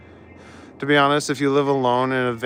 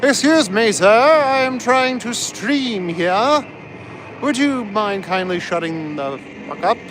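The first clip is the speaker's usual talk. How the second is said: high, slow, mock-polite British accent; put-on British accent, very high and slow; 8 semitones higher, slower than usual. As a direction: scold the helicopter in a prim British accent. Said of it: "What, my British voice?".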